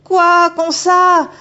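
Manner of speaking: put-on high, loud frightened girl's voice rising to a cry; a schoolgirl losing her nerve, ending in a yelp for help; panicked young girl. Megumi panicking at the otter woman, high frightened girl voice.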